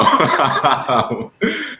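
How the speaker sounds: loud, high burst of laughter; amused belly laugh, real; hearty laugh. Real, not acted. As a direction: laugh out loud at your own joke. Laughs at own cowboy joke.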